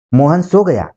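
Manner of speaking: normal talking voice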